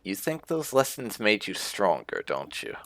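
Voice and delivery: ordinary delivery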